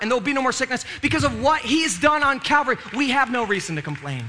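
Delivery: rising, fervent preaching that swells; preaching build. Acted. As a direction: build it up like a revival climax.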